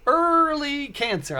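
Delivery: high, slow stunned echo; stunned repeat. Retold or imitated. Repeating the diagnosis aloud, stunned disbelief. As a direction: say it like you can't believe it. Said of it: "I said it like that".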